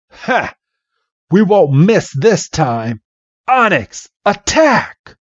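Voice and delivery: scoff, then high battle call; taunt, then cry the order dramatically; hammed-up cartoon trainer; rival's attack command.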